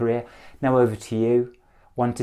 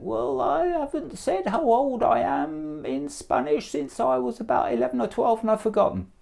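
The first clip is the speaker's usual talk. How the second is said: imagined student's thought; 10 semitones higher than usual. Retold or imitated. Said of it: "one or two of you are thinking".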